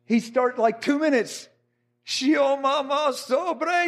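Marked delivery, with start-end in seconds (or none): 2.0-3.9 slow, higher-pitched tongues chant; mimicking fervent tongues, raised pitch; imitated praying in tongues. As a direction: draw out the syllables like ecstatic prayer.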